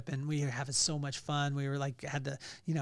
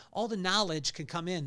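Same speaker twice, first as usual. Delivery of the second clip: ordinary talk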